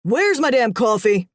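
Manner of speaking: put-on booming boss voice; booming demand. A narrator's reading. Boss demanding coffee from the other room, booming. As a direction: boom it out impatiently to the next room. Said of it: "a familiar booming voice called".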